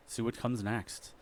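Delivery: ordinary delivery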